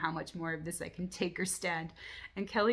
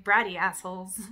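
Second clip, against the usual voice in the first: sleep-talking outburst; 10 dB louder than usual. Retold or imitated. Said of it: "I woke myself up talking in my sleep".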